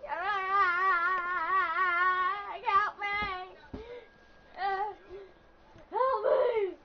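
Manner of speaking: screaming for help. Pretend. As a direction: yell for help in mock terror. Fake cries for help.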